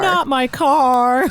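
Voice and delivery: high, drawn-out wail; slow hammed-up cry of protest; mock dismay. Reacting as the owner while mummies walk on car, mock dismay.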